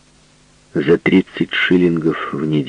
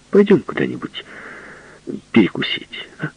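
pleading; 12 semitones higher than usual. Acted. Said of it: "умоляюще сказал он".

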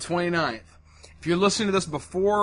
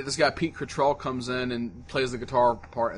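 ordinary delivery

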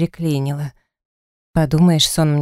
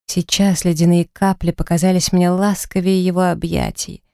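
ordinary delivery, nothing marked

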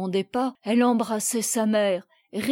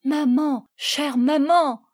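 higher-pitched, joyful call; 4 semitones higher, 4 dB louder than usual. A narrator's reading. Lucie greeting her mother, overjoyed. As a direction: call out to Mama with delight. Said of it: "si joyeuse, si éclatante de bonheur".

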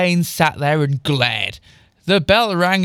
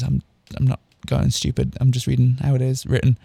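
ordinary delivery

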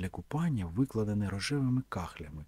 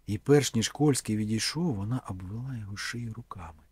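ordinary delivery

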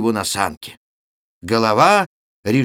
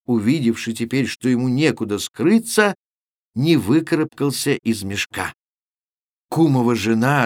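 usual speaking voice

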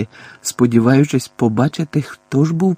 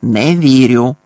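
loud, curt dismissal; immediate contradiction; 6 dB louder, slower than usual. A narrator's reading. Aunt flatly refuses to believe her nephew, loudly contradicting. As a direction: reject it at once, forcefully. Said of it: "відразу заперечила тітка Петунія".

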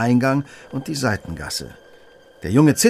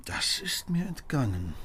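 a soft, breathless whisper to himself; hushed whisper; 9 dB quieter than usual. Acted. Detective whispers his own oversight, hushed. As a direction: whisper it, half to yourself. Holmes admits missing the clue.

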